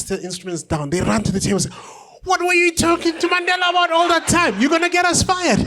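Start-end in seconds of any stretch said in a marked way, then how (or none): 2.2-5.6 very high, louder panicked squeal; mock panic from the band; panicked bandmates. Bandmates panic at the singer, high squeal.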